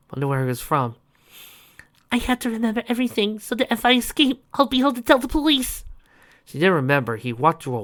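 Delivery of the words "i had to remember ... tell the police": put-on high, fast frightened girl. Captive girl thinking.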